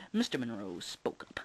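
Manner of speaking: usual speaking voice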